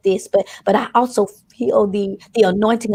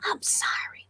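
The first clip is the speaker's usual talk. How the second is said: whisper; 14 dB quieter than usual. Acted. Whispered confession in prayer.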